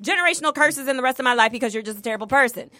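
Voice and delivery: high, building imagined tell-off; mock rant. To an ex, an imagined high rant. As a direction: vent it in a mock rant, rising.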